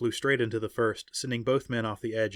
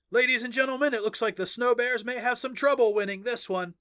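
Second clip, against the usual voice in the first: high, loud arena announcer; excited announcer; 11 semitones higher, 3 dB louder than usual. Acted. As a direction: hype the crowd like a sportscaster. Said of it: "the announcer said".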